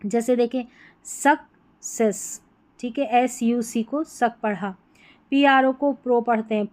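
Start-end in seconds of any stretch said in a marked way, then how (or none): 1.0-2.4 सक्सेस slowly, split into "suk" and "ses"; syllable-by-syllable pronunciation. Shown as a demonstration. Teacher giving an example of the ending, सक्सेस slow and split. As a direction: break सक्सेस in two with a gap. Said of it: "सेस करके इसका उच्चारण होगा".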